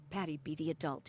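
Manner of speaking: low, soft imitated coaching; steady her with a firm, soft word; quiet coaching. Husband coaching wife during a fight, low and quiet. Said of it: "he'd be just coaching me".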